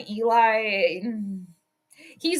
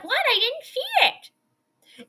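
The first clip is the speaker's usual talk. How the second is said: high, slow, put-on voice; mock clueless referee; 12 semitones higher, much slower than usual. Streamer mimicking the ref who missed it, high mock innocence. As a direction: play the oblivious ref, drawn out and high. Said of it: "The ref's like".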